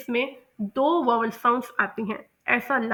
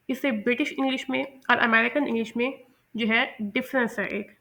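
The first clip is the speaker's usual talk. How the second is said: usual speaking voice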